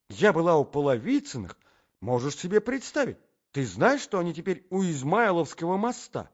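high, loud, dramatic lady's tale; breathless gushing. Acted. Lady spins her husband a tale of a sleigh crash, gushing. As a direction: gush it out in a high, excited voice.

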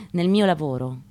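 usual speaking voice

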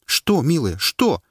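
high, loud desperate plea; pleading. Acted. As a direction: beg her to speak. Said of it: "почти взмолился Паша". (муж почти умоляет жену)

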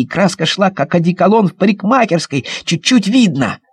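ordinary delivery

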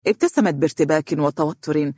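usual speaking voice

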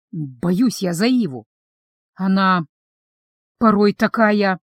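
slow, halting, hesitant delivery; searching for words. A narrator's reading. Vampire worried about his friend, haltingly choosing words. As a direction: pause as you pick each word. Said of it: "Он явно подбирал слово".

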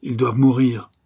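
narrated boozy threat; brandy-worn voice. A narrator's reading. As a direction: demand it as a drink-worn bandit. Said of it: "une voix évidemment rouée par le brandy".

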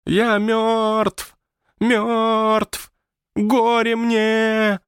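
very high, drawn-out ghost wail; hammed-up horror-film haunting wail; ghostly wailing. A narrator's reading. Ghost imitating horror-film spooks to annoy the house, wailing. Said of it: "издавал обычные для привидений звуки".

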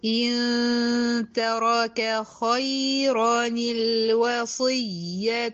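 slow chanted tajweed recitation; Quran recitation. Shown as a demonstration. Teacher recites the verse. (تلاوة مجودة بطيئة)

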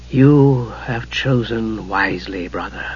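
slow, soft, dark triumph; cool and dark. A narrator's reading. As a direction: savour the win coolly. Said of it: "cool and dark".

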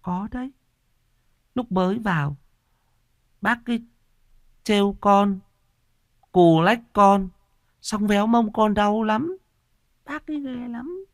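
put-on very high, halting child voice; small child tattling in high, broken bursts; insistent lisping toddler. A narrator's reading. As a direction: argue back in baby talk, phrase by phrase. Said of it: "khăng khăng cãi lại bằng giọng ngọng líu lô".

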